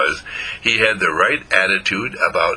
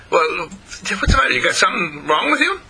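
high, puzzled skunk voice; incredulous storybook skunk, pitched up; baffled skunk; 8 semitones higher than usual. A narrator's reading. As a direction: question the dog's sanity in a raised voice. Skunk baffled by dog's love.

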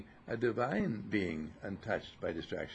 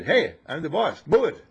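louder commanding bark; bossy farmer; 5 semitones higher, 8 dB louder than usual. Acted. Farmer ordering the stubborn mule to move, loud and bossy. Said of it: "and said".

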